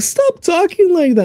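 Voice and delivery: very high, whiny child imitation; flustered kid. Kids begging dad to drop the accent, flustered whine. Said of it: "they get so flustered".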